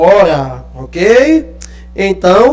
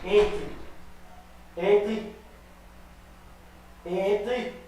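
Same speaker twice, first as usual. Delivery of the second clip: distant, much softer commands away from the mic; calling the dog off-mic; 19 dB quieter, much slower than usual. Real, not acted. Teacher sending a stubborn dog back inside, distant off-mic calls. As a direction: call out from across the room.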